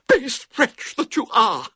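high, loud melodramatic indignation; trembling with anger. A narrator's reading. Girl held prisoner to her captor, trembling with anger. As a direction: rage at your captor in a high voice. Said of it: "in a voice that trembled with anger".

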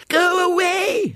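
put-on high, drawn-out shriek of a little girl; child's scream. A narrator's reading. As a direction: scream it at her, stamping. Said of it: "screamed Violet Elizabeth again".

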